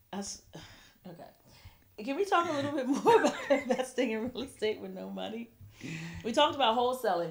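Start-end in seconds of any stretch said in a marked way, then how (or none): 0.1-5.5 laughing. Real, not acted. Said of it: "I swear to God, he is like".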